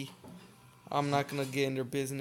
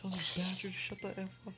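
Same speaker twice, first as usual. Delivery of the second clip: angry whisper; 4 semitones higher, 11 dB quieter than usual. Real, not acted. Annoyed at laughing dudes.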